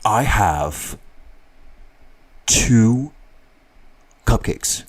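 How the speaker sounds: halting character voice with long pauses; put-on voice, very deliberate and spaced out; mystery character. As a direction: dole out the words one by one, in character. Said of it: "Who am I?".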